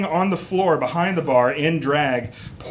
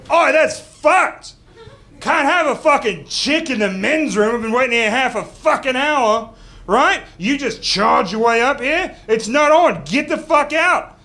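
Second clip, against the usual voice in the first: high, loud outraged yelling; angry biker; 10 semitones higher, 5 dB louder than usual. Retold or imitated. Comic voicing a biker lieutenant protesting from the line, furious. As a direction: bellow it at a queue-jumper.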